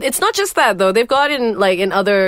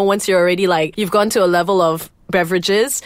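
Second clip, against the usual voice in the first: usual speaking voice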